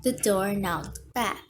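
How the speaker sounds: ordinary delivery, nothing marked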